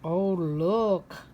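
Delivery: usual speaking voice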